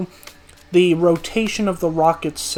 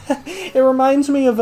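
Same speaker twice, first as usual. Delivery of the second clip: laughing; 7 semitones higher than usual. Real, not acted. Restarts phrase while laughing.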